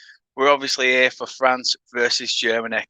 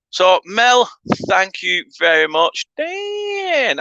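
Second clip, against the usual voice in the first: hint of a Canadian accent, higher pitched; accidental Canadian accent; 7 semitones higher, much slower than usual. Real, not acted. Streamer thanking Mel for a comment, accidental Canadian accent. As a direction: slip into a Canadian lilt while reading aloud. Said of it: "read that in such a Canadian accent".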